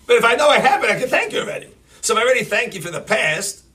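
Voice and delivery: rising fervour. Acted. Preacher urging the crowd to thank Hashem, rising fervour. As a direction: build the excitement toward the point.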